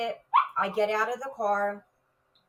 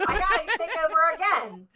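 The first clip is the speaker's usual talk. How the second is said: high, loud line through laughter; laughing; 8 semitones higher, 5 dB louder than usual. Retold or imitated. Officer joking she must take over again, laughing. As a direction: laugh as you say it, pitched up. Laughing at her own takeover.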